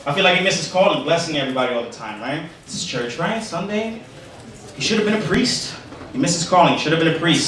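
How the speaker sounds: ordinary delivery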